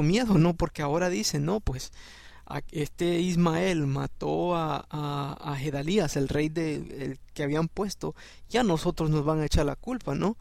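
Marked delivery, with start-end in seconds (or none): none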